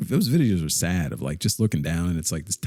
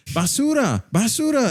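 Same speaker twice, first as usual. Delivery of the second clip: mock yell; 14 semitones higher, slower than usual. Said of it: "her yelling at me all the time".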